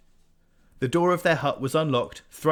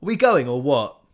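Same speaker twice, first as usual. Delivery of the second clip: louder, calling out; raised voice; 3 semitones higher, 10 dB louder than usual. A narrator's reading. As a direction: raise your voice to be heard through the grating. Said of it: "raising his voice".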